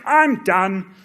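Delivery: high, loud declaration of quitting; abrupt giving up. Retold or imitated. Quoting himself on the treadmill, loudly giving up. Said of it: "I was like".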